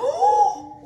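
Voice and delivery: sharp high gasp of wonder; delighted gasp. Real, not acted. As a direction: gasp in amazement. Magic milk colors start moving.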